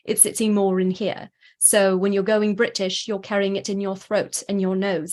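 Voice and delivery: British accent, carried in throat and nose; British accent demonstration. Shown as a demonstration. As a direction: speak British, placed further back. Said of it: "when I'm working in my British accent".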